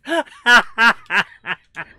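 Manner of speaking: loud, high burst of laughter; real belly laugh, let it ring out; hearty laugh. Real, not acted. Gamer amused by a useless guard dog, loud laugh.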